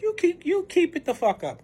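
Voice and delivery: high-pitched retold dare; heated warning. Retold threat to the other woman.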